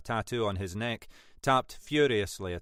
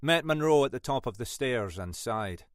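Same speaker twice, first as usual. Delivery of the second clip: ordinary delivery, nothing marked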